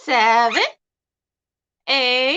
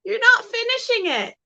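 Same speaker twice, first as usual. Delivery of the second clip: high pet-talk voice; 11 semitones higher than usual. Real, not acted. Teasing uncooperative parrot.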